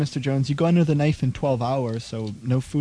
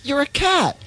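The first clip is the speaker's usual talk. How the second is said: high, loud burst of surprise; astonished; 10 semitones higher, 5 dB louder than usual. Acted. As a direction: blurt it out in disbelief. Nurse realizes visitor is a cat.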